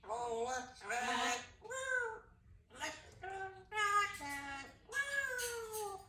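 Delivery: excited whoops. Real, not acted. Parent cheers the child riding a toy. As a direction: whoop it up for the ride.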